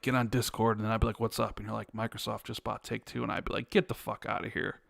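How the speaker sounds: hushed, understated back-and-forth; low-key retold exchange. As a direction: play both sides of a call under your breath. Retold imagined call with insider friend.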